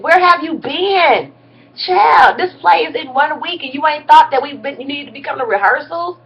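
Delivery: lightly voiced stern director calling her out; a hint of a fed-up director scolding. Director lady quoted scolding.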